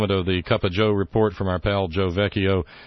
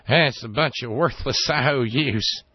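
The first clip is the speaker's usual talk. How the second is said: mocking Bush; 5 semitones higher than usual. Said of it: "Dear leader did and said".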